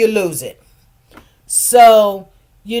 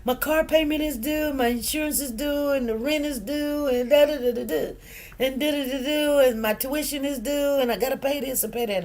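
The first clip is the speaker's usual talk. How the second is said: high, pleading daughter voice; distressed daughter; 6 semitones higher than usual. Retold or imitated. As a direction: list the bills in a panic. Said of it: "She said".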